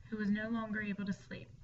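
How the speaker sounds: plain reading voice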